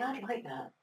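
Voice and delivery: softly voiced inner thought; customer's private thought. Speaker voices customers drawn towards a product, quiet inner thought. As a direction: say it softly, as if thinking it. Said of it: "They think".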